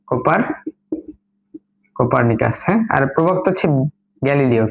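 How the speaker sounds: normal talking voice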